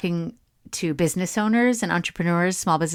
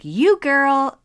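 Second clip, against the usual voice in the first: higher, louder punchy cheerleading; pep-talking influencer; 8 semitones higher, 5 dB louder than usual. Retold or imitated. Imitating an inspiring woman online to her followers, pep-talk voice. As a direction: imitate her hyping someone up. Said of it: "she is just like".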